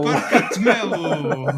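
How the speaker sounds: drawn-out announcer call, slow and loud; ring announcer. Pretend. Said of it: "para ring announcers".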